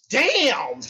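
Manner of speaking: high, drawn-out awed "damn"; awed exclamation. Retold or imitated. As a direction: re-enact being floored by the sight. Awed reaction in gym story.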